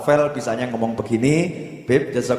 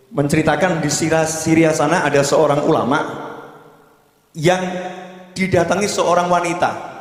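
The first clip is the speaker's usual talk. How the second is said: ordinary talk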